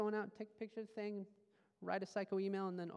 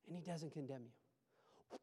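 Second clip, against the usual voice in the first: tender; 8 semitones lower, 14 dB quieter, slower than usual. Acted. Tender pastoral assurance.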